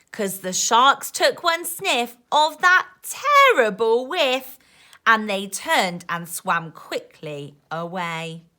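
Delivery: put-on lively rhyming read-aloud for kids; reading to children. A narrator's reading. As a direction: bounce the rhymes like a bedtime book. Reading rhyming picture book aloud.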